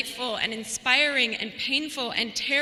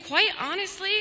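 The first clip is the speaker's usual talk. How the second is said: ordinary talk, nothing marked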